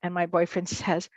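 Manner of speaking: normal talking voice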